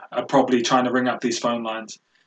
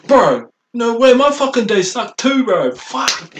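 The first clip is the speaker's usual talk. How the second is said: high, loud bro voice; frat bro; 10 semitones higher, 5 dB louder, slower than usual. Retold or imitated. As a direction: hype bro, slower and pitched up. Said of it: "some dude might say".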